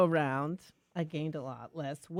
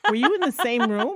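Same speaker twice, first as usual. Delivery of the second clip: laughing; 4 semitones higher, 7 dB louder than usual. Retold or imitated. Husband's teasing retort, laughing.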